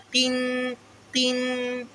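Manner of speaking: قن with the qaf made a little light; قن said with a light ق and a short clear i; thin qaf. Shown as a demonstration. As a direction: say قن with a slightly thinned ق, not majhool. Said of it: "قاف کو ذرا سا باریک کریں گے".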